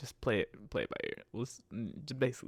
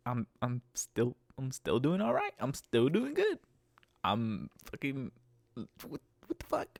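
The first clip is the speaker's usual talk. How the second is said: escalating mock answer. Driver mock-answering passenger again, building exasperation. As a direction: build from flat to exasperated squeak.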